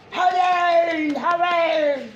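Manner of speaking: high, loud, drawn-out hooray; celebratory cheer. Real, not acted. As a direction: shout it long and high in triumph.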